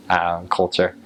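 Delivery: ordinary delivery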